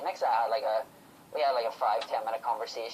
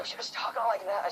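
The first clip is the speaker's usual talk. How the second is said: imitating a hoarse talker, higher pitched; hoarse throat; 8 semitones higher than usual. Fan imitates wrestler with hoarse throat, lightly hoarse. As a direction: put on a hint of a hoarse throat. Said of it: "a, a really hoarse throat".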